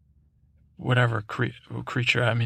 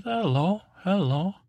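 high, sing-song hello, much higher than his own voice; odd greeter voice; 7 semitones higher than usual. Acted. Creature greeting the podcaster, high sing-song hello. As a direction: greet like a strange little creature.

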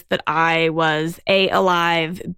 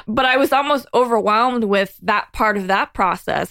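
ordinary talk

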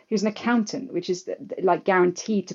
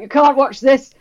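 put-on loud, higher French-accented scold; thick French accent; 7 semitones higher, 11 dB louder than usual. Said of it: "in his thick French accent".

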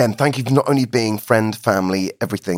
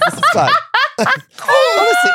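laughing; 4 dB louder than usual. Real, not acted. Laughing hysterically at the machine.

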